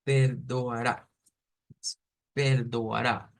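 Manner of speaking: slow "perdoará", per-do-a-rá; separated syllables. Shown as a demonstration. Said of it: "que son sílabas como separadas".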